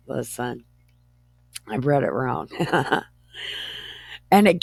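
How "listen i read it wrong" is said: ordinary delivery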